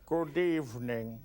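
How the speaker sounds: slow, low, droll Hitchcock drawl; deadpan host greeting, slow and low; Hitchcock impression. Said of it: "Let's do some Hitchcock".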